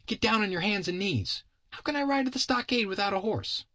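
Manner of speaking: put-on very high child's voice giving orders; bossy kid. Kid orders Bill to be his horse, high bossy child voice. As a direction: order the grown-up about like a small boy.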